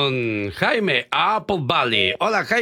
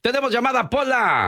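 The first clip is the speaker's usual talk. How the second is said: high cue call; 10 semitones higher than usual. Acted. Host cues the sidekick bit.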